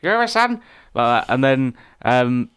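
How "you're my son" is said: high, loud shop lady impression in a Chinese accent; mock Chinese accent. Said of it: "That's a really good impression".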